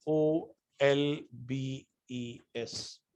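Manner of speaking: letters O L B E S spelled one by one, very slow and soft; spelled out slowly. Shown as a demonstration. Teacher to class spelling the reaction name, slow letter by letter. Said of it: "के".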